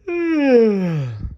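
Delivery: high stretching yawn sound; a big sleepy baby yawn. Acted. Baby dino yawns.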